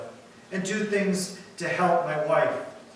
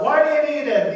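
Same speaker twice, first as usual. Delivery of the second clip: higher, louder shouted demand; mock yell back; 7 semitones higher, 6 dB louder than usual. Superhero's wife answers her husband, mock yelling. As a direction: play a wife hollering back from another room. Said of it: "She yells out".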